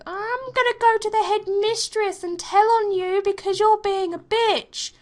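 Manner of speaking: high, whiny tattletale voice; mimicking petty classmates threatening to tell; mocking bratty schoolgirl. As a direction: sneer like a snitching kid.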